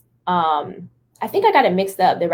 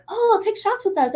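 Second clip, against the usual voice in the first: party people; 13 semitones higher than usual. Retold or imitated. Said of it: "people were like".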